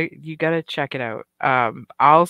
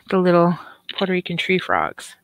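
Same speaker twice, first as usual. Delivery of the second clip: usual speaking voice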